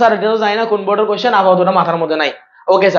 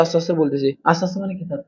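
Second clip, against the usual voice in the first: dropping to a much softer, hushed voice; playfully soft; 2 semitones lower, 8 dB quieter than usual. Pretend. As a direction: say it very softly, teasing a request to speak gently. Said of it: "ছোট করে বলবো যে". (মজা করে খুব আস্তে, নিচু গলায়)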